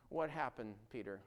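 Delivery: ordinary delivery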